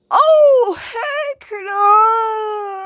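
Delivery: a high, loud cry of refusal, pitch jumping way up; shrieking refusal. Acted. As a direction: scream it out as if the worst news ever. Outburst when music announced.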